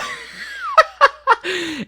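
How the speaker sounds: squealing laugh; high laugh. Real, not acted. Laughing mid story.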